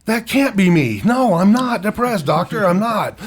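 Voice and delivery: high, loud retold protest; indignant denial. Patient rejects the doctor's diagnosis, loud high acted denial.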